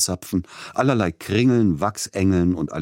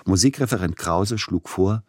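ordinary delivery

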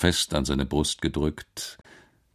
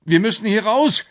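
high, loud cry to escape; frightened boy calling out; urgent shout; 7 dB louder than usual. Acted. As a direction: shout in panic, pitched way up. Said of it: "rief Alex".

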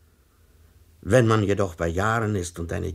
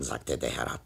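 ordinary delivery

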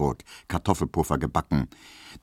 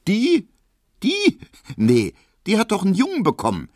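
very high, fast scornful gossip; scoffing landlady; 5 dB louder than usual. Acted. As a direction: squeal out the scandal. Piesecke scoffs at Hedwig.

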